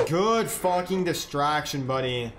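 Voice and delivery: usual speaking voice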